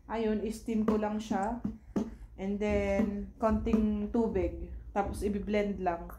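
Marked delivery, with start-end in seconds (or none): none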